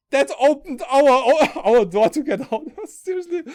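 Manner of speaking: high, loud, breaking into laughter; laughing disbelief. Real, not acted. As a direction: laugh through the absurd punchline. Laughing at absurd ticket mishap.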